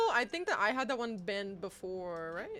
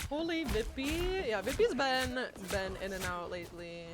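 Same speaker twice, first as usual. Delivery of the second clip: usual speaking voice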